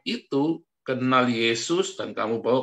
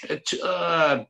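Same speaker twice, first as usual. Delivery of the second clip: a hint of crowd cheering, higher and far-off; 6 semitones higher, much slower than usual. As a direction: chant it like cheering supporters. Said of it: "Cuman sorak-sorai saja".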